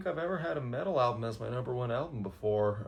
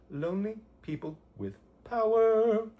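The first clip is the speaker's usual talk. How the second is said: hammed-up title call; 11 semitones higher, 6 dB louder than usual. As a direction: belt the album title like a triumphant announcer. Recap of number one pick.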